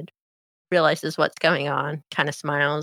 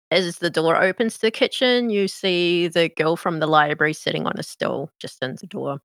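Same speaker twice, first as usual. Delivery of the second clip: ordinary talk, nothing marked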